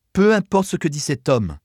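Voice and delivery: showy defensive pronouncement; pointed declaration. A narrator's reading. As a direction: declare it for all to hear. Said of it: "déclara-t-elle ostensiblement".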